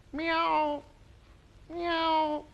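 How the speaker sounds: high, drawn-out meow; high kitty call; cat meow. Shown as a demonstration. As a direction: mew like a cat, high and loud. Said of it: "The cat".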